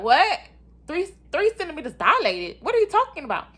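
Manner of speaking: loud, high-pitched incredulous outburst; hammed-up shock, demanding an explanation; shocked disbelief. Reacting to nurse's shocking news.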